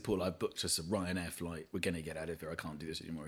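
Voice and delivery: low, soft, defeated murmur; subdued retelling. Retold or imitated. Comedian tells friend they are leaving the clinic, low and quiet. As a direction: drop right down, as if giving up.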